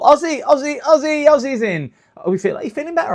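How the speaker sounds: high, loud singsong name call; excited greeting. Real, not acted. Streamer cheers as regular viewer Ozzy joins, high and loud. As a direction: chant the name to welcome a friend.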